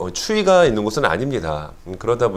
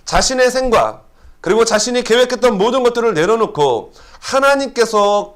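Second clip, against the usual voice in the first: fervent preaching; 7 semitones higher than usual. Acted. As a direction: preach it with rising fervor. Preaching at full force.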